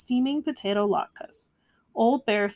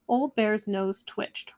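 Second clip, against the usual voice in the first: ordinary delivery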